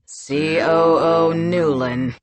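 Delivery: slow, low, curt title; impolite. Acted. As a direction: drop all politeness, slowly. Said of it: "with no pretense of politeness".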